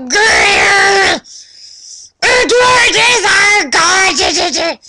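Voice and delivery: high, loud fangirl shout; fan cheer. Acted. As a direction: yell it like a screaming fan at a concert. Fan cheer in author's note.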